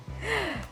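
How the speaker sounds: short high-pitched soft laugh; a quick, light, high giggle. Real, not acted. Laughing at own question about accent, short soft laugh.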